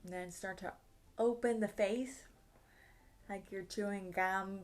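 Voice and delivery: usual speaking voice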